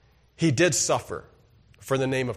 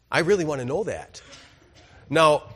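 ordinary talk

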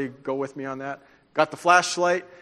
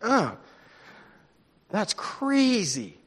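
stunned reaction; 4 semitones higher, much slower than usual. Retold or imitated. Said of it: "I'm like".

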